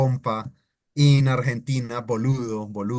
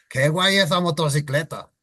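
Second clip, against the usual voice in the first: Castilian accent, lifted pitch; Spain Spanish accent; 4 semitones higher, faster than usual. Shown as a demonstration. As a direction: imitate a Spaniard. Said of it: "imitating them because that's how they sound".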